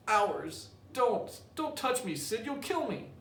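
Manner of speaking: high, slow fake pain; hammed-up agony. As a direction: overplay the hurt. Tom fakes agony.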